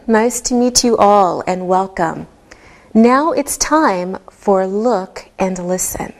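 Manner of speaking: normal talking voice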